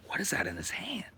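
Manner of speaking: high, unvoiced puzzled whisper. A kid wonders what Joe holds, puzzled whisper. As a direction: whisper it, squinting in curiosity. Curious aside at meeting.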